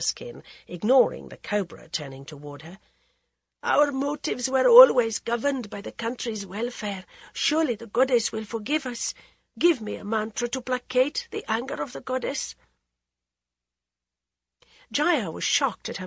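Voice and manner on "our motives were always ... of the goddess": a queen's anxious plea, a little higher; pleading queen. Acted. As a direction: beg the holy woman for a remedy. Maharani begs for mantra.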